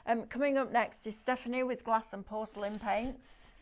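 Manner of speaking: ordinary talk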